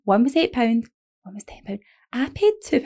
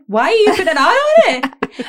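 much higher and louder, fast-rising protest; mock-outraged plea to mum; exasperated outburst; 9 semitones higher, 5 dB louder than usual. Arguing with mum over gorilla, exasperated outburst.

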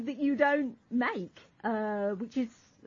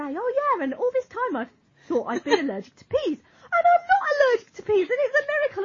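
much higher, louder, overjoyed imitation; mock joyful; 12 semitones higher, 5 dB louder, faster than usual. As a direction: gush like it's a miracle. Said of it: "turn around and say".

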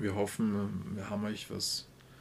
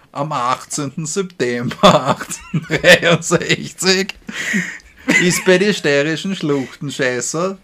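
loud, giggling through the words; can't hold back laughter; laughing; 6 semitones higher, 15 dB louder than usual. Real, not acted. Reading intro, breaks into laughter.

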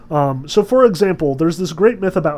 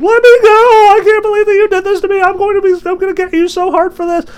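much higher, louder whiny squeal; whining and upset; 10 dB louder, faster than usual. Retold or imitated. Aphrodite caught naked in the net, whining high and loud. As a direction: squeal like a trapped, furious goddess. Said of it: "whining and upset".

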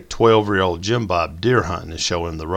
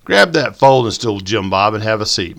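chiding; 2 semitones higher, 7 dB louder than usual. A narrator's reading. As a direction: boss him around with a grin. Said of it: "Mike chided him".

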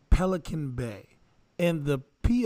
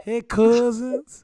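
slow, louder, higher New Orleans greeting; New Orleans accent; 4 semitones higher, 6 dB louder, slower than usual. Acted. Cohost opens the listener letter in a New Orleans accent. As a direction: drawl hey cousins in a New Orleans accent. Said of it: "read the whole thing in the New Orleans accent".

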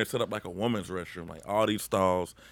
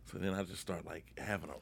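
much softer, hushed storytelling; hushed retelling; 11 dB quieter than usual. Retold or imitated. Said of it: "so nobody knows me".

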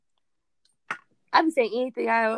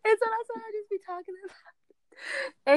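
laughing; 10 semitones higher than usual. Real, not acted. Laughing fit while telling story.

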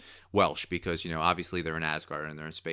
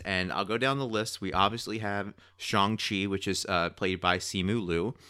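ordinary talk, nothing marked

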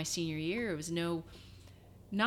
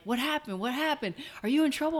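excited; 8 semitones higher, 4 dB louder than usual. Retold or imitated. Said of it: "they're like".

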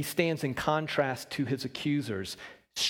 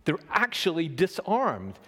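normal talking voice

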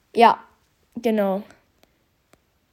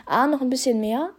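ordinary delivery

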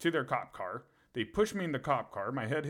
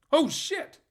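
much higher, louder "Oh, shit!"; startled cop; 9 dB louder than usual. Retold or imitated. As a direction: yelp like a cop caught off guard. Surprised cop finds naked prisoner.

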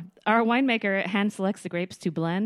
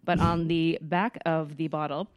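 normal talking voice

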